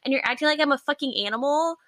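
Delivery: mock outrage at a pet-talking date; indignant outburst. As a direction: demand what is happening, fed up. Indignant retort to the guy.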